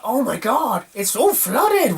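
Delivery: high, drawn-out gasp of shock; a high, slow, astonished cry at the flooded fields. Retold or imitated. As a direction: stretch it out in pure disbelief. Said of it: "was like".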